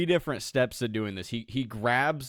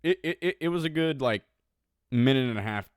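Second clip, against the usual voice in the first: ordinary talk, nothing marked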